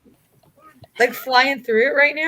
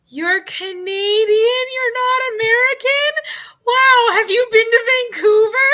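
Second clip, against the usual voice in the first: high, excited voice building to a shout; gushing Europeans; 13 semitones higher, 7 dB louder than usual. Retold or imitated. Imitating excited Europeans meeting a Canadian, high and gushing. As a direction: gush about Canada like an awestruck fan. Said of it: "they were like".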